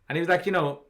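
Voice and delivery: usual speaking voice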